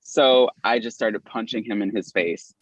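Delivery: usual speaking voice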